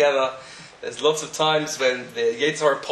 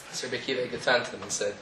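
ordinary talk, nothing marked

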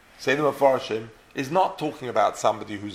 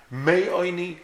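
"Mei Oini" lifted higher to isolate Mei; single out "Mei Oini" as the key phrase; stress on Mei; 5 semitones higher than usual. Shown as a demonstration. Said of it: "Why the word mem?".

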